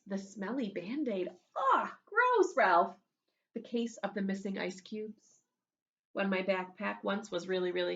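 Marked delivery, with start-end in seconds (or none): 1.3-2.9 high, loud mock disgust. Real, not acted. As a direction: recoil at something gross. Reacting to smelly band-aid.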